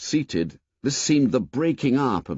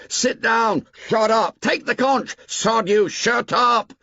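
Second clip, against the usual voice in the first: much higher, loud shouted interruptions; boys shouting; 12 semitones higher, 4 dB louder than usual. A narrator's reading. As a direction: yell them down in a rowdy crowd. Assembly storm of shouting boys.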